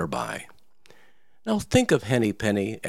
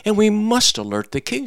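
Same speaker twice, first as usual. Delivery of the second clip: frantic hen crying the alarm; 11 semitones higher than usual. Acted. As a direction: plead with the rooster in a fluster. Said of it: "she cried".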